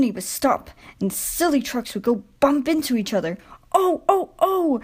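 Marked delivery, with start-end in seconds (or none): 3.7-4.8 high, startled cries again; crying out. A narrator's reading. Silly trucks bumping into each other, crying out. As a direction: yelp as you bump together. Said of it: "they cried again".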